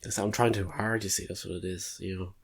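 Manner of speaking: ordinary talk, nothing marked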